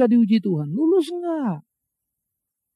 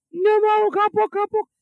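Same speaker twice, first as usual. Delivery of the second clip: tinggi dan keras, merengek; anak kecil kapok; 10 semitones higher, 6 dB louder, slower than usual. Retold or imitated. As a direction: rengek kapok seorang anak. Anak kapok setelah terluka. (anak kecil merengek kapok)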